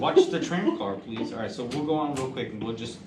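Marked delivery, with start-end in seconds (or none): 0.0-1.3 high, laughing tram car call; tram announcement voice. Retold or imitated. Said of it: "wa-, watch".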